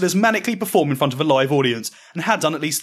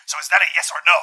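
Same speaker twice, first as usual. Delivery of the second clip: Vince McMahon voice; faster than usual. Acted. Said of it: "questions Vincent Kennedy McMahon".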